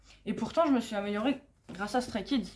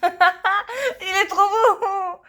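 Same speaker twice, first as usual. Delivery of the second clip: very slow, high, laughing gush; swooning squeal; 10 semitones higher, 6 dB louder, much slower than usual. Real, not acted. Fan gushing over her favourite idol's photocard: squealing swoon.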